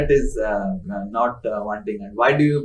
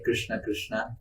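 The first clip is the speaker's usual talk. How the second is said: softer, lightly voiced Radharani calling Krishna; Radharani's voice; 11 dB quieter than usual. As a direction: parrot gently mimicking Radharani. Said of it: "in Srimati Radharani's voice".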